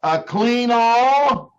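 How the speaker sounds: high, slow disbelief on clean all; stunned. Acted. Salesman hearing of yet another vacuum, stunned and slow. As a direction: drag out the shock.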